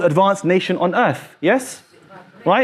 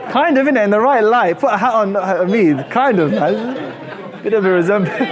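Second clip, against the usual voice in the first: amused, laughing; 2 semitones higher than usual. Real, not acted. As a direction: tease through bubbling laughter. Joking with class about a lookalike.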